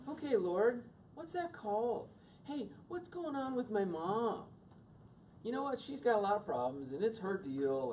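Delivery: high-pitched, put-on reasonableness; hammed-up sweet pondering, easing down at the end; mock mature self-talk. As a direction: play an absurdly understanding kid. Parodies improbably mature teenage self-talk.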